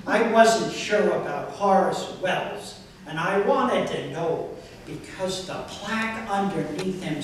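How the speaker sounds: deadpan comic poetry recital with deliberate phrasing; poem reading. Acted. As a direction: read it out line by line to an audience, punching key words.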